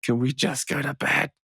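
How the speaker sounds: tired plea to go to bed; weary calm plea. Retold or imitated. Tired parent begging kids to sleep, slow and laughing. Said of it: "calmly saying".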